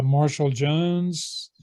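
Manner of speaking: ordinary talk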